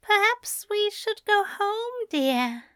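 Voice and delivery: high, smiling suggestion. A narrator's reading. Aziraphale suggesting home to his dear, smiling. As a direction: lift it high with a smile, easing down on dear. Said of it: "Aziraphale said with a smile".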